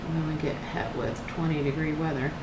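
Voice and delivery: normal talking voice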